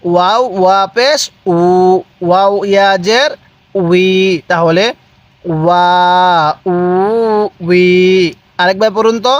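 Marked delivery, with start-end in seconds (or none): 0.0-4.1 measured spelling chant with "উ" held long; lilting letter names, level long "উই"; drawn-out vowels. Shown as a demonstration. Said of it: "কিভাবে?". 5.5-7.9 "ওয়া, উ, উই" each held long and level; measured syllable chant of "ওয়া, উ, উই"; drawn-out vowels. Shown as a demonstration. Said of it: "এক আলিফ টানের নিয়ম".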